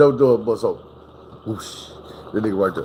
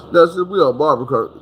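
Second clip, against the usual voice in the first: ordinary delivery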